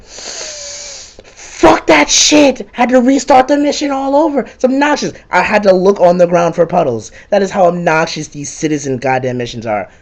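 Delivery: high, squeaky outburst settling down; exasperated rant. Real, not acted. Gamer ranting about restarting the citizen escort mission, exasperated. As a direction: vent in high-pitched exasperation.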